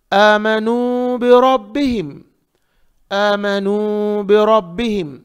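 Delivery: बिरब्बिहिम with a thick, rounded ra; heavy ra. Shown as a demonstration. Teacher models the verse for students, heavy ra. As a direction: recite आमनू long, then heavy ra in बिरब्बिहिम. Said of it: "रा को मोटा पढ़ेंगे".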